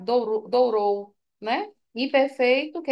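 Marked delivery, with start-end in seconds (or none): 0.0-1.2 dourou, dourou said slowly and deliberately; slowed teaching emphasis on dourou; slow emphatic repetition. Shown as a demonstration. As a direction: spell out dourou, dourou slowly for students. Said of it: "Dizer pretérito perfeito, dourar".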